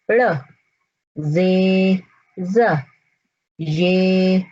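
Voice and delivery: each letter name, then its short bare sound. Shown as a demonstration. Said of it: "इनका नाम क्या है हरूफ तहज्जी का और इनकी आवाज कैसी". (हर हर्फ़ का नाम, फिर उसकी आवाज़)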